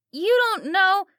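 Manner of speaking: put-on high, loud pleading girl; Kelly crying out. A narrator's reading. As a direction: defend her dad, desperate. Kelly protests.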